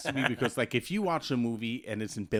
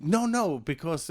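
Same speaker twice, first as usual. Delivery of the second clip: usual speaking voice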